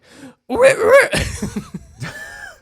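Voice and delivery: shrill cartoon ape squeal, very high and loud; mock Donkey Kong screech; high squealing laugh. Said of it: "He sounds like".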